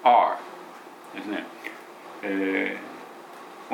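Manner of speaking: ordinary delivery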